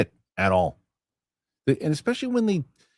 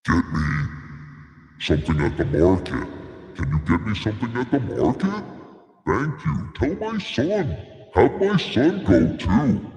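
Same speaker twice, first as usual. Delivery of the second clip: Hutt speaking basic; 2 semitones lower than usual. Pretend.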